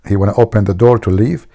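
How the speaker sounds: ordinary talk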